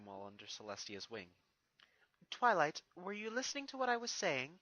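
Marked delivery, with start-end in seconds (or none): 2.3-4.5 a light, higher regal voice; mild royal mentor; Celestia. Acted. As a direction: gently catch a distracted student. Celestia calls Twilight back.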